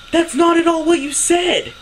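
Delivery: loud, high-pitched protest; exasperated, high and loud; indignant retort. Retold or imitated. Retold argument, exasperated retort to mother.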